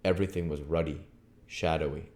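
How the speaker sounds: usual speaking voice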